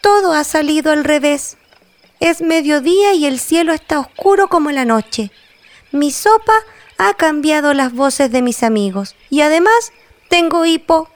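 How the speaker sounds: high, upset little voice; a worried character whose world went wrong; high voice, at a loss; fretful character voice. Acted.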